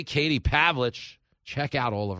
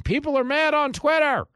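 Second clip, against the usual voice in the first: much higher, loud overblown announcing; mock headline; 7 dB louder than usual. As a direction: hype it like a clickbait writer. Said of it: "write an article saying".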